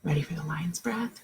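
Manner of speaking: soft unvoiced whisper; hushed question. Real, not acted. Teacher whispers to kids.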